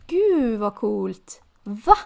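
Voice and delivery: loud burst of amazement; excited. Real, not acted. Player amazed by the game, loud. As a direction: blurt it out, thrilled.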